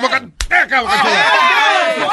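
high, slow gushing call; excited high squeal. Real, not acted. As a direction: gush over it with delight. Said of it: "me emocioné".

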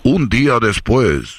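very slow, low dramatic caption; deep narrator voice. Acted. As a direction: stretch out the time skip. Narrator time-skip caption.

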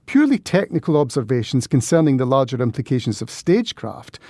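ordinary delivery, nothing marked

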